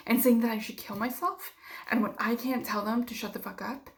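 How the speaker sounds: ordinary talk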